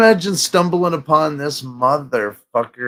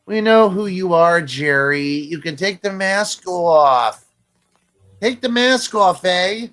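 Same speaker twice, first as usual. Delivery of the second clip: exasperated mock Canadian; 5 semitones higher than usual. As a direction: plead in a put-on Canadian voice. Mock Canadian heckling masked Jerry.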